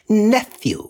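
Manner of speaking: sharp, high stern rebuke. A narrator's reading. Uncle sternly silences his nephew, sharp rebuke. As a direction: cut him off sternly. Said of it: "returned the uncle sternly".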